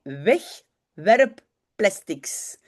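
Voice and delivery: Wegwerpplastics spelled out in sound, very slowly; slow Dutch word. Shown as a demonstration. Teacher pronouncing the new word for French speakers, very slowly. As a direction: stretch Wegwerpplastics syllable by syllable. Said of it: "Est-ce que vous voyez?".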